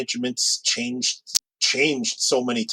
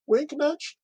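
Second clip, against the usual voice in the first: spoken as a much higher, teasing aside; playful wink nudge. Real, not acted. Hoping for a casting break, said with a cheeky high wink. Joking self-aware aside.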